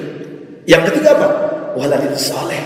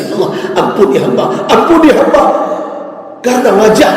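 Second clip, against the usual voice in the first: high, loud anguished plea to God; a remorseful father crying out in prayer; desperate prayer; 11 semitones higher, 3 dB louder than usual. Acted. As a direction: beg for forgiveness, breaking.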